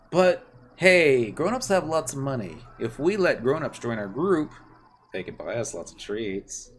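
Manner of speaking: hammed-up kids' gang. Streamer voicing the Star Onion kids, hammed-up and bossy. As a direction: play the proud little brigade leader.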